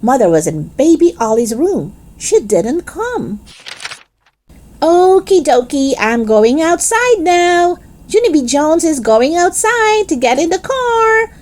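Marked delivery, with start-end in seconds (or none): 4.8-11.3 louder shout. Acted. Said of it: "I shouted louder".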